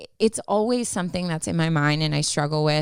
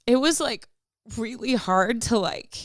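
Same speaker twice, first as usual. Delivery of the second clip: crying voice; 6 semitones higher than usual. Real, not acted. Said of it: "this is, like, my crying voice".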